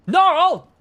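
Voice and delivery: shouted name, high and loud; loud call out. Real, not acted. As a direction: call the viewer out sharply. Calls out a viewer.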